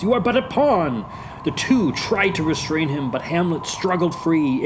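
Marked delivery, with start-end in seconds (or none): 0.0-1.0 high-pitched warning plea; imploring. Acted. Friends warning Hamlet against following, imploring. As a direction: plead urgently. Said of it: "implored his friends".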